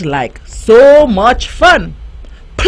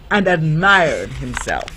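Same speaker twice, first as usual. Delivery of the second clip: ordinary delivery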